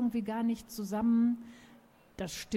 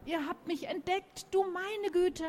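high, flustered bird voice; a startled dodo calling out high; panicky bird calling; 10 semitones higher than usual. A narrator's reading. Dodo caught by visitors. (hohe, aufgeregte Vogelstimme)

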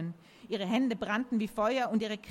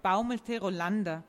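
ordinary delivery

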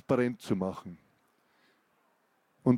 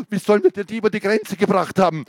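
higher, louder and fast; frantic shout; 6 semitones higher, 7 dB louder, faster than usual. Acted. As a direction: fire it out in agitation. Agitated outburst, waving at photos.

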